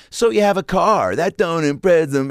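high, drawn-out mock diva dismissal. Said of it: "be like".

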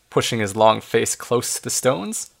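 plain reading voice

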